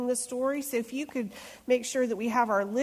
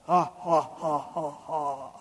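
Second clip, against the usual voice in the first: maniacal laugh; 7 semitones lower, slower than usual. Acted. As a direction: lower mock-evil group laugh trailing off. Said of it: "Join me in the maniacal laugh".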